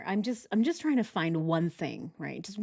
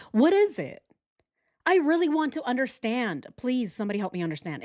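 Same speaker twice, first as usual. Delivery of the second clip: exasperated; 5 semitones higher than usual. Real, not acted. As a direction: demand an answer, pitch jumping up. Exasperated demand for an explanation.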